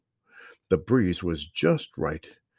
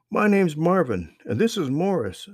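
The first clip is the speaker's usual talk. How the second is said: put-on high, loud friendly boy; young boy voice; 10 semitones higher, 5 dB louder than usual. A narrator's reading. Strange boy greets the lost children, high friendly voice. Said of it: "said the boy".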